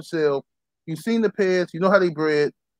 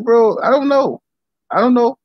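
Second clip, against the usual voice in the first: higher, louder, curt refusal; dismissive brush-off; 6 semitones higher, 3 dB louder than usual. Retold or imitated. Instagram stranger asks for Ram's info, higher dismissive brush-off. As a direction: shut down the stranger asking.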